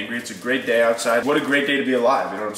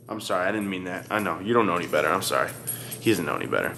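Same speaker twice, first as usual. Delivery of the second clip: much lower, soft apologetic murmur; tender apology; 8 semitones lower, 6 dB quieter, faster than usual. Real, not acted. Apologizing gently to his dog after scolding, low and soft. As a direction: soothe the dog you just scolded.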